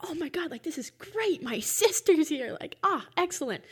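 acted-out high, delighted reaction; excited relief. Dreamer hears sister's voice in the dark cabin, excited.

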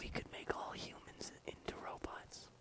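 hushed plotting whisper. Pretend. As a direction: whisper the evil scheme.

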